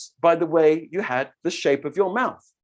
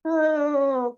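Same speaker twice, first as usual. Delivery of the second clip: higher, frail oh; old mother; 10 semitones higher than usual. Shown as a demonstration. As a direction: sigh it like an old woman. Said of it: "What voice comes out".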